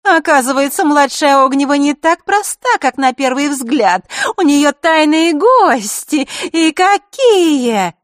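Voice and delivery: put-on high, slow, scheming girlish voice; sly girl's voice. A narrator's reading. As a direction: purr it out like a crafty schoolgirl plotting. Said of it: "коварно улыбаясь".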